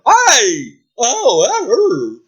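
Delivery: high, loud mock yelp of pain; pained dragon. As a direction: yelp as if spanked. Dragon gets smacked.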